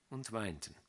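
ordinary delivery

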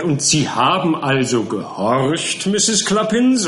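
slow, high, knowing cross-examination; sly prosecutor. A narrator's reading. Prosecutor Buzfuz catches the witness out, slow and sly. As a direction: draw the question out slyly.

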